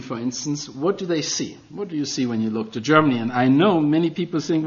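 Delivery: ordinary talk, nothing marked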